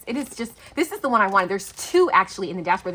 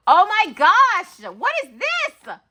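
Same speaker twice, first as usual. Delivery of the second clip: surprised squeal; 11 semitones higher, 8 dB louder, slower than usual. Real, not acted. Surprised by new item.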